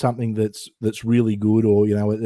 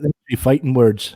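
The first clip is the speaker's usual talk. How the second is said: slow fighting words; 3 dB louder, much slower than usual. Pretend.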